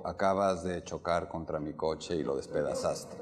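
low, subdued, no exclamation at all; deliberately unexcited. Shown as a demonstration. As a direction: report a car crash with none of the anger. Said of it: "No le dices a un tipo".

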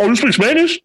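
high-pitched astonished question; acted surprise. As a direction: play a coworker caught off guard. Acting coworker's surprised discovery.

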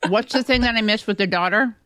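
chewing while talking; words round a mouthful; mouth full. Real, not acted. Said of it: "I'm eating a cucumber".

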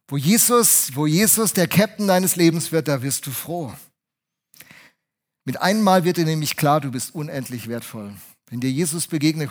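ordinary talk

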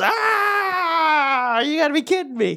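high, drawn-out mock wail; mock outcry. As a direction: imitate someone crying out in disbelief. Mocking reaction to hearing y'all.

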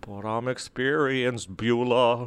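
slow sultry [name] impression. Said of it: "become [name] when singing".

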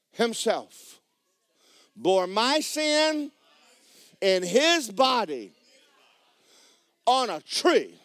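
ringing pulpit reading with long pauses; declamatory scripture reading. Acted. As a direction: proclaim each phrase, drawing it out. Reads scripture to the congregation.

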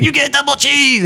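high-pitched insistent counterman cry; imitated yelling. Cook overruling a customer's order, mock yelling. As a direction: mimic the cook overruling her at a holler. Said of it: "he starts yelling at her".

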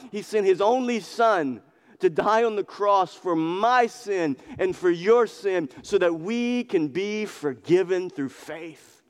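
rising revival preacher cry; preaching at full force. Acted. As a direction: plead with the crowd to believe. Impassioned gospel appeal.

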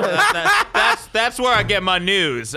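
laughing defence of his news source. Real, not acted. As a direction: protest while cracking up. Laughing at Newsroom jab.

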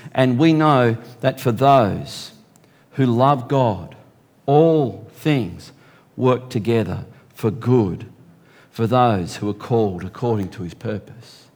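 preacher reading Romans to the church; scripture reading. Acted. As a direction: read it out slowly, with weight. Reads scripture aloud.

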